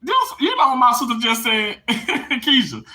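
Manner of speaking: high, tearful laughing voice; laughing and crying. Real, not acted. Telling viewers what sister said, laughing and crying. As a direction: laugh through happy tears. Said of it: "I'm laughing and crying simultaneously".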